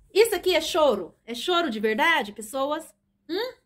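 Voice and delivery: high, playful questioning. Real, not acted. Mother teasing a whimpering child. (provocando a criança, voz aguda e brincalhona)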